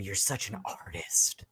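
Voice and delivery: softly whispered taunt; creepy whisper. Retold or imitated. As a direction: breathe it like a creep. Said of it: "he's like".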